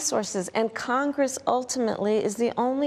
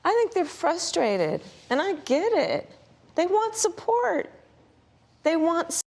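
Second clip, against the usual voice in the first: higher inflection; 6 semitones higher than usual. Real, not acted. Vice president defends border agents, voice climbing higher. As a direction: voice rising higher and slower. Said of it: "now you start hearing the inflection in her voice".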